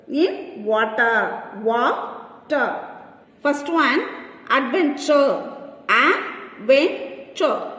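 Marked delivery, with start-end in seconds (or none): 1.6-2.9 "Water" split into two beats, Wa, ter; syllable by syllable. Shown as a demonstration. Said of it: "there are two syllables". 5.9-7.7 "Adventure" slowly in three beats, Ad, ven, ture; slow, separated syllables in "Adventure". Shown as a demonstration. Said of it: "Three syllables".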